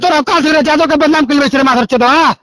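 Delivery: furious cursing, voice up at times; angry abuse. Real, not acted. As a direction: rage at him for shaming the community. Abusive quarrel on phone call. (गुस्सा से चिल्लात गारी)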